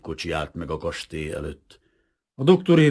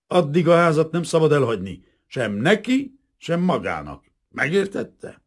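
loud, high, threatening order; menacing threat; 9 semitones higher, 8 dB louder than usual. A narrator's reading. Lieutenant orders house arrest, loud menacing contempt. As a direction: lay down the law with contempt.

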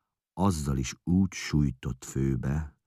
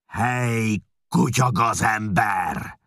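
much higher, louder shout, slow at the end; Csát bellowing at the forger; furious shout; 9 semitones higher, 8 dB louder, slower than usual. A narrator's reading. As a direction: scream it with rage. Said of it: "ordította olyan hangon".

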